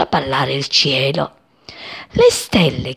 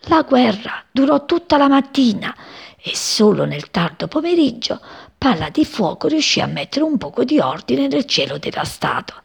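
usual speaking voice

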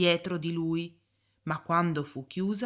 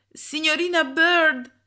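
much higher, loud cry; desperate call; 10 semitones higher, 7 dB louder than usual. A narrator's reading. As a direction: call her back in alarm. Boy cries out warning. (richiamo disperato, molto acuto e forte)

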